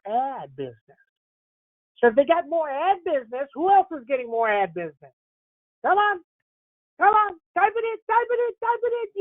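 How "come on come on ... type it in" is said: much higher, eager coaxing of the chat; urging chat on. Real, not acted. Host pleads with his stream chat to type, eager and high. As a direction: egg them on to type, pitch climbing.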